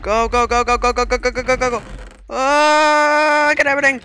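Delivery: high, loud, rapid-fire urging; frantic urging. Real, not acted. Player racing to grab items, frantic urging. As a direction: rush it out in a high panic. Frantic rush at game start.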